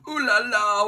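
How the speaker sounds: high, hammed-up French "ooh la la"; put-on French exclamation; mock Frenchman. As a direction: toss it off like a cartoon Frenchman.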